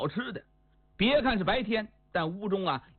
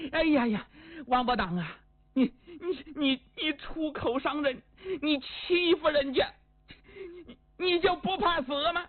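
high, stammering, sobbing threat; tearful outrage; 7 semitones higher than usual. Acted. Insulted woman threatens Wang Bodang with death, crying with rage. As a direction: choke on the insult, voice pitched way up.

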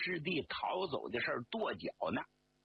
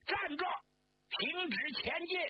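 sentry's challenge pitched far higher; high shout. A narrator's reading. Battalion commander halting approaching men, high shout. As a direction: call a halt, high and sharp. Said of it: "他马上高喊".

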